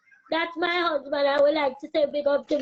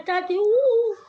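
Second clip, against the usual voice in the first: excited cheer; 6 semitones higher, 6 dB louder than usual. Real, not acted. Cheering her own name.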